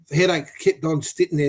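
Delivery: usual speaking voice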